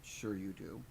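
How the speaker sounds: lofty, unimpressed put-down; haughty. A narrator's reading. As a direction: brush it off with cool disdain. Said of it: "Tandy sniffed haughtily".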